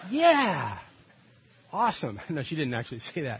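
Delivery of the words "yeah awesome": a playful imitation of the wife's eager yes; mock wife reply. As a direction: tease her with a mock "yeah, awesome". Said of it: "She went".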